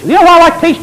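rising high and loud; excited question. Real, not acted. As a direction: lift it up, almost shouting. Preacher's rising rhetorical question.

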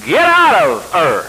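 loud, slow, drawn-out order; commanding shout. Retold or imitated. The Lord ordering Abraham to leave, loud slow command. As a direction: boom it out as a divine command, stretching the words.

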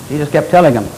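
ordinary talk

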